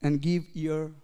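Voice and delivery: measured, solemn Bible reading; solemn scripture read to the congregation. Acted. Reads scripture aloud.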